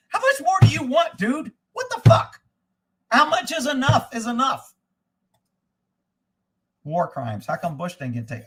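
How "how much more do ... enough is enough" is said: exasperated outburst. Real, not acted. Outraged rant about Putin's wealth.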